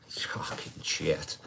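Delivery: slow, breathy whispered gripe; muttered whisper. Real, not acted. Streamer grumbling to viewers about his lost teeth, whispered. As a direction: drop to a whisper in disgust.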